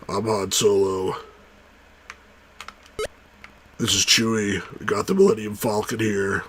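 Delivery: lightly voiced laid-back Han Solo; Han Solo impression. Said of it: "Han Solo's like".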